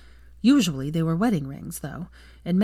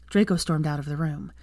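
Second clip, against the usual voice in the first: ordinary delivery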